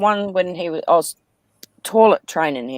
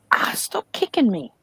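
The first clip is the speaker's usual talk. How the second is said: whispering; 4 semitones higher, 4 dB quieter than usual. Real, not acted. Aside to pet, hushed.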